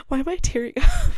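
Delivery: high, tearful voice breaking into laughter; tearing up. Real, not acted. Vlogger surprised by their own tears, tearful and half laughing. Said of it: "I don't know why I'm crying".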